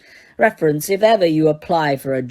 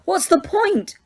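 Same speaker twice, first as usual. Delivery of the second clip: put-on desperate girl; 7 semitones higher than usual. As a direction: plead in panic. Hermione fake crying.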